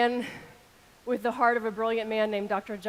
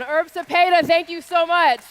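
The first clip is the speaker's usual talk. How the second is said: loud, high public thank-you; shouted thanks; 6 semitones higher, 10 dB louder than usual. Real, not acted. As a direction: call out thanks across the hall.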